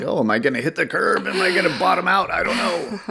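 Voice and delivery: high, rising fretful self-quote; worried driver. Retold or imitated. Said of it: "it's like".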